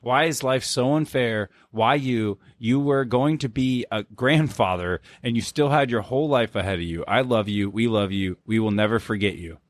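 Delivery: ordinary talk, nothing marked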